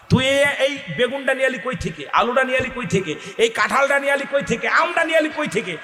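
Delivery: high-pitched scolding questions, one after another; scolding parent. Retold or imitated. Parent demanding where the son got the stolen vegetables, scolding high. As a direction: grill the boy about where he got it.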